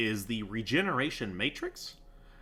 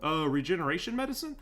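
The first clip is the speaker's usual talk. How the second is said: lightly mocked higher-pitched viewer voice; imagined viewer's question; 6 semitones higher than usual. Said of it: "And you're like".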